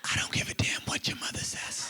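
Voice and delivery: raspy whispered father's threat; mock whisper. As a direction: hiss it like a sneaky dad. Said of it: "Why are you whispering?".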